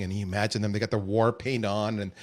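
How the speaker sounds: ordinary talk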